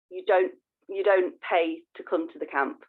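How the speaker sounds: ordinary delivery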